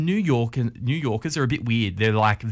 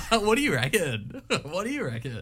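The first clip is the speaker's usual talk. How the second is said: high, laughing through the words; 8 semitones higher than usual. Real, not acted. Joking about an Australian saying, laughing and high-pitched. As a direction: repeat it while cracking up. Laughing as they repeat the phrase.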